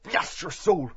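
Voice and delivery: halting, gasped speech between sneezes; gasping. Acted. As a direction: struggle to speak through a coming sneeze. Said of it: "Ned Lambert gasped".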